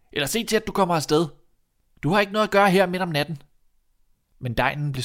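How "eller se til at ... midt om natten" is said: high, raised challenge; calling out. Acted. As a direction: order an intruder away. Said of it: "råbte drengen". (højt og bydende råb)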